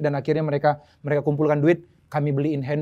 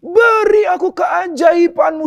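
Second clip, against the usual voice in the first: high, loud, slow cry for a miracle; imitating a friend's desperate screamed prayer; retold pleading shout; 7 dB louder, much slower than usual. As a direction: beg God at full volume, drawing the words out. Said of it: "dia teriak".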